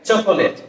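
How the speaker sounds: চকোলেট with a full middle o; common mispronunciation. Shown as a demonstration. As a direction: say চকোলেট as cho-ko-let. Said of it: "This is not". (ভুল উচ্চারণে চকোলেট, "চ-কো-লেট")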